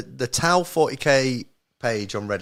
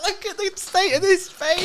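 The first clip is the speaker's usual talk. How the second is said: high mock exclamation.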